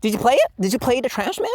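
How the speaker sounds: high, loud, fast fake excitement; mocking developers hyping their new game; mocking hype bro. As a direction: parody a hyped-up fan. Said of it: "just say".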